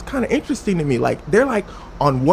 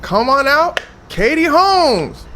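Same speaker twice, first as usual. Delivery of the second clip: raised, stretched-out guest announcement; show host introduction; 6 semitones higher, 5 dB louder, slower than usual. Retold or imitated. Oprah brings out Katie Holmes, drawn-out announcing. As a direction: call the name out long to a studio crowd.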